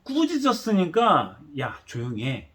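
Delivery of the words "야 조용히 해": soft calm shush, no irritation; gentle hush. Shown as a demonstration. Preacher showing a calm hush, not the crowd's. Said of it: "그런 게 아니라 신경질적으로".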